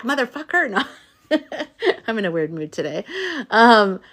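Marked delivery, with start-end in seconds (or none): 1.3-2.0 short high giggle at her own joke; a real little laugh. Real, not acted. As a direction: break into a quick laugh.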